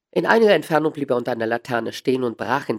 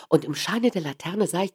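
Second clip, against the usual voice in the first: ordinary delivery, nothing marked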